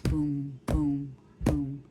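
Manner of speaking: low, loud booming giant steps; booming footsteps. Acted. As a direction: boom each step out low and heavy. Giant's footsteps shaking the room.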